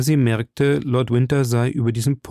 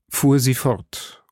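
plain reading voice

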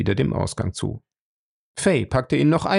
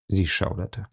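plain reading voice

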